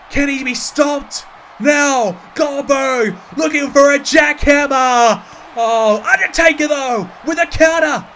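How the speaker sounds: high, loud, frantic ringside call; wrestling commentator. Acted. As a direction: scream the big moves like a live announcer. Live wrestling play-by-play.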